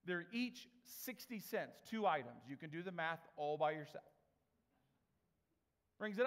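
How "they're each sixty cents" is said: each sixty cents said slowly, every word weighted; slow emphatic statement. Shown as a demonstration. Preacher stressing the price to the congregation, slow and weighted. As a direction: lay it out slow so it sinks in. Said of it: "that's important".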